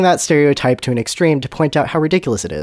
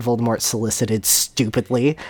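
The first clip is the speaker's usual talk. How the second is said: plain reading voice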